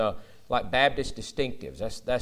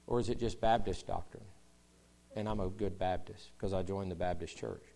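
ordinary talk

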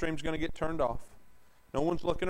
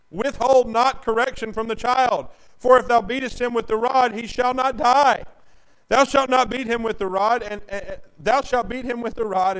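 preaching scripture; 6 semitones higher, 9 dB louder than usual. Acted. Preacher reading Proverbs to his church, booming. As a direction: thunder the verse at the pews. Reads scripture at full force.

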